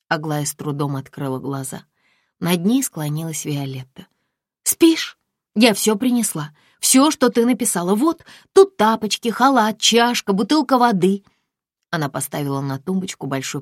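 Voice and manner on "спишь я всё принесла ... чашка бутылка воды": put-on higher, louder bustling girlfriend; chatty friend. Friend unpacks things at the bedside, chatty. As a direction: list the items brightly.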